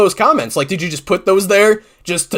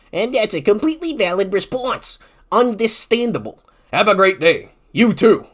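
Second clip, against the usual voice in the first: higher, lightly mocking Redditor voice; mock polite reply; 4 semitones higher than usual. Mocking a polite Reddit exchange, sarcastic sing-song. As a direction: ham up a fake-polite online sign-off.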